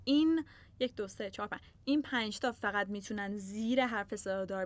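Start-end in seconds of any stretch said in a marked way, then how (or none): none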